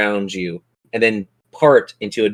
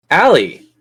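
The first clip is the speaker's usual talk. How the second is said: louder, higher name called across the table; calling out; 5 semitones higher, 5 dB louder than usual. Real, not acted. Game master calls the next player to her turn, loud call.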